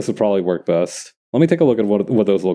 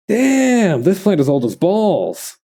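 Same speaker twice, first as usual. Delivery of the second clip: amazed; 9 semitones higher, much slower than usual. Real, not acted. Amazed at the plant's age.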